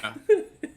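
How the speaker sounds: loud, squealing burst of laughter; real helpless laugh; high laugh. Real, not acted. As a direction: crack up at the players.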